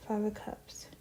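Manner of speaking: soft whisper, naming each card; whispered card names. Real, not acted. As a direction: breathe the card names out quietly.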